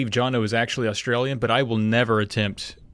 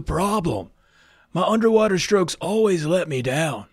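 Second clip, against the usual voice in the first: Keanu; 5 semitones higher than usual. Acted. Hopeful swimmer asking Joe for tips, surfer-dude impression. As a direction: play a laid-back film surfer, higher than usual. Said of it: "maybe I'll do a little Keanu".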